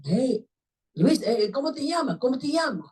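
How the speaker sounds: high, insistent retold questioning; urgent rescuer. Rescuer asking crash victim's name in Spanish, urgently. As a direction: keep calling for the victim's response.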